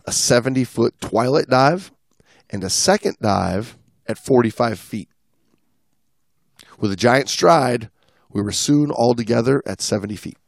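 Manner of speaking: lightly read-aloud travel story voice; reading a dive log. Acted. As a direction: read the log entry out to the listeners.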